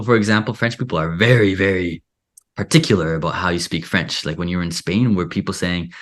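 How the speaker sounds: normal talking voice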